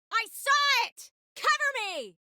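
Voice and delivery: high-pitched combat shout; urgent call. Acted. Fighter calls to a companion for cover, urgent high shout. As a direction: yell it out, voice leaping high.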